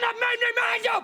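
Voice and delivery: high, loud final declaration; shouted resolve. Acted. Man telling his friend his mind is made up, shouting. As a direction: bellow the decision at him. Quiet then shouted repeat.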